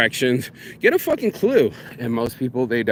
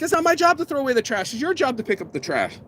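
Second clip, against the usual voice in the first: higher, indignant protest; indignant retort; 10 semitones higher than usual. Retold or imitated. Answering the bar girl in Thailand, indignant and high. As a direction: snap back that it's her job. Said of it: "I'm like".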